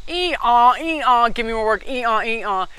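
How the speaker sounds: loud mock donkey hee-haw; hammed-up braying while begging for work. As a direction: bray like a worn-out work donkey. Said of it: "I was literally doing that".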